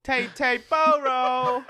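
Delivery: teasing Texan drawl; laughing mock Texan. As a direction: drag out a giggly Texan Tay Tay Boro. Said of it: "how the Texans would say it".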